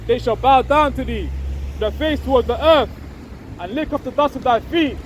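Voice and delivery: measured, elevated reading of scripture. Acted. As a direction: proclaim the verse slowly like a preacher. Reads Isaiah aloud.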